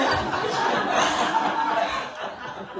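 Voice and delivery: bursting into high laughter; loud laugh. Real, not acted. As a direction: crack up at own story. Embarrassed laughter after story.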